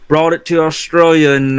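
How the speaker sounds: mock Australian accent; a hint of an Aussie accent. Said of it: "pretty upset with your Australian accent there".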